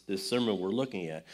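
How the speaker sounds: ordinary delivery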